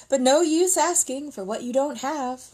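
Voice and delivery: high, loud sigh of false regret; mock resigned soldier. Soldier baiting villagers about cabbage, mock resigned. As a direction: pretend there's no hope.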